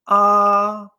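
"A" long, high, level and loud; first tone. Shown as a demonstration. Teacher shows vowels keep the same tone, "A" high and flat. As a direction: hold "A" steady on a high note. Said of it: "tener ese mismo tono".

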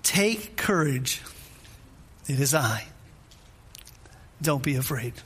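slow, measured comfort, with long pauses; quietly steadying, each phrase left to sink in; calm reassurance. Acted. Jesus reassuring the frightened disciples.

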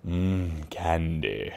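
low, soft, hungry mmm; low savoring murmur. Acted. Savoring candy.

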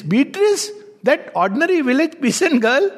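high-pitched disbelieving question; mock incredulity. Poet questioning the ferryman about his guide, incredulous. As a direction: echo the name as if you can't believe it.

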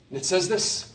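ordinary talk

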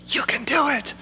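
soft, high, coaxing murmur in the ear; sweet-nothings whisper. Retold or imitated. Preacher voicing our self-improvement projects, soft and coaxing. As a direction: coax gently like a tempting voice. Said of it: "they whisper these sweet nothings".

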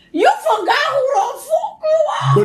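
high, drawn-out mock yell; exasperated shout. Telling people who they really are, high exasperated shout. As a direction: wail it at someone who needs waking up. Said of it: "be like".

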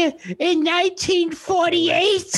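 put-on very high, slow old-lady caricature; like a cartoon loony being strangled; strangled high comic granny voice; Ethel. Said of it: "Sounds like one of the loonies".

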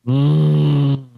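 loud, drawn-out hum in character; Sling Blade. Acted. Cohost grunting at a movie question, Sling Blade voice. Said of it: "Why are you doing the Sling Blade voice?".

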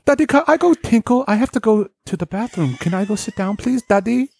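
high mock toddler pleading; little kid voice. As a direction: beg daddy like a tiny child. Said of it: "My kid, my kid definitely talks like that".

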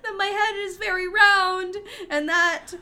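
high, loud pretend crying; mock wail. Woman complaining about being teased, mock-crying wail. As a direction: wail it in comic self-pity.

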